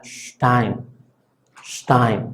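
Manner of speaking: Stein: la s casi sh, dientes juntos; s cerrada alemana. Shown as a demonstration. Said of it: "juntáramos los dientes de arriba y de abajo". (Stein con s cerrada)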